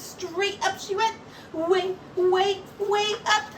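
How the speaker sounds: usual speaking voice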